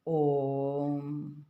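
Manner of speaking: ओम drawn out very long, low and steady; three-beat prolonged vowel. Shown as a demonstration. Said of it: "तीन मात्रा का समय लगता है".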